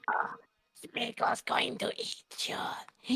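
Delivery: slow, hissing creature whisper with a high, strained pitch; Schmeagol whisper. Acted. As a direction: creep it out slowly like Gollum. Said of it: "I know how it sounds".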